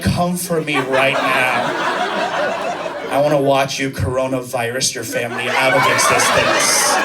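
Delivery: low-pitched, deliberate dare; mock menace.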